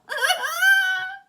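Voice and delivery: high cackling laugh; sharp high laugh bursting out; shrieking laugh. Real, not acted. Laugh after the rant.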